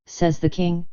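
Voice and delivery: usual speaking voice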